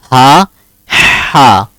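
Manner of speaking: plain ha, then ha pressed from the throat; paired ha sounds. Shown as a demonstration. Teacher modelling both ha sounds for the student. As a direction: contrast an easy ha with a throaty one. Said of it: "Can you pronounce it?".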